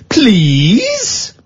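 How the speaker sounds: high, drawn-out mocking "Please?"; mock snippy please. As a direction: sarcastically demand manners with a rising "Please?". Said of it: "And she said".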